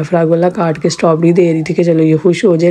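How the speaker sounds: ordinary talk, nothing marked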